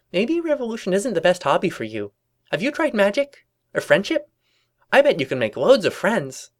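high, lilting cartoon voice; cheery persuading pony. Pinkie suggesting a new hobby, high cheery coaxing. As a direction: coax with high, bright enthusiasm.

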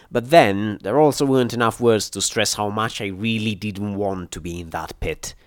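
ordinary delivery, nothing marked

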